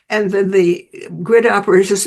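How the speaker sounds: normal talking voice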